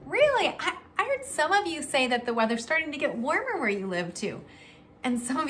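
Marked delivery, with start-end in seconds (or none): 0.0-0.5 high surprised really. Acted. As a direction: sound amazed by the answer. Surprised reply to kids.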